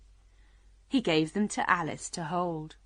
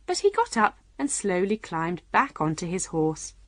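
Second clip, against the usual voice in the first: usual speaking voice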